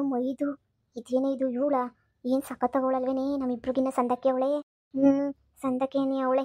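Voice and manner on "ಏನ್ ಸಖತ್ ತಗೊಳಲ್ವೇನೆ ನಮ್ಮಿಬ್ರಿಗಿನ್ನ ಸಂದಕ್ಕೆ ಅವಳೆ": ordinary talk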